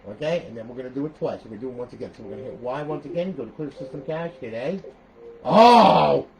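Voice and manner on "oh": long, very loud "oh"; loud outcry. Real, not acted. Host reacting mid-tutorial to the console, loud outcry. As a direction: burst out with a drawn-out cry. Sudden outcry while pressing buttons.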